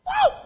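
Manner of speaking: high-pitched excited whoop; celebratory whoop. Acted. Preacher acting out joy over a bonus, high whoop.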